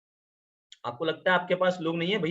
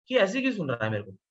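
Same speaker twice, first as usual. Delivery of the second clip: mild irritated thought. Retold or imitated. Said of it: "ऐसे दुश्मन वाली फील नहीं आ रही".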